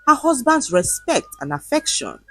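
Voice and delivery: ordinary delivery, nothing marked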